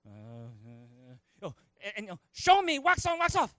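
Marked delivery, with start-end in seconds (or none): 0.1-1.5 low, very soft hesitant noises; mock dumbfounded murmur; baffled mumble. Acting baffled Daniel's response. 2.3-3.5 high, loud rapid-fire order; hammed-up karate master's command; Mister Miyagi. Acting Miyagi repeating the command.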